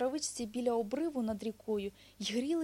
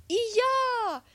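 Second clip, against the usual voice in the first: very high, loud joining-in cry; eager shout; 13 semitones higher, 9 dB louder than usual. A narrator's reading. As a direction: hedgehog shouting to follow along. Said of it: "крикнув їжачок".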